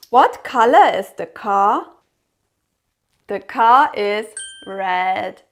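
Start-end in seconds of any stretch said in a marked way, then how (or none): none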